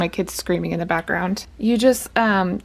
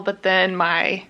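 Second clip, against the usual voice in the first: ordinary talk, nothing marked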